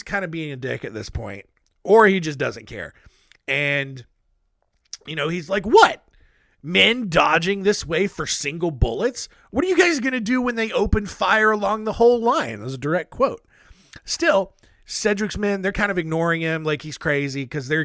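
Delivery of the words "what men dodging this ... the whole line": loud, higher-pitched scornful officer; mock incredulous scolding. General to his ducking soldiers, mock incredulous scolding. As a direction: act out a general berating flinching men.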